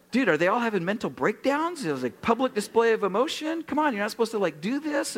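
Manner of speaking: high, incredulous bewilderment; can't believe what he's seeing; baffled convert. Retold or imitated. Baffled young convert at crying church.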